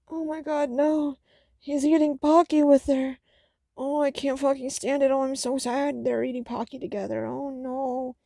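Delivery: high, hammed-up anguish over a cute scene; mock despair. Real, not acted. Reacting to a Pocky-sharing scene with high, hammed-up mock anguish. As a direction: whine it like you can't bear it.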